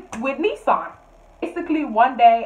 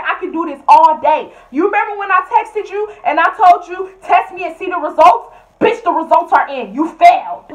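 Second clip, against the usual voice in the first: higher, louder taunt; taunting yell; 7 semitones higher, 8 dB louder than usual. Retold or imitated. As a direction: gloat loudly at her. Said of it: "I said".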